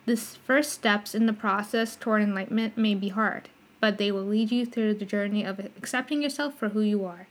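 ordinary talk, nothing marked

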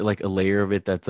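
ordinary talk

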